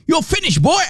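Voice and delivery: high, mocking taunt; mock Hijab taunt. Mocking the debater, a high sneering taunt. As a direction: sneer it like a bully. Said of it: "Muhammad Hijab would have said".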